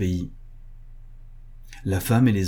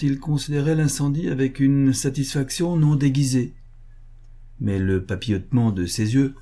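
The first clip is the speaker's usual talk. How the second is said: usual speaking voice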